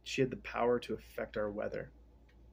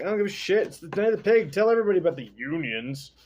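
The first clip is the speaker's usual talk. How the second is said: Pharaoh voiced; 6 semitones higher, 6 dB louder, faster than usual. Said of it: "And the Pharaoh's like".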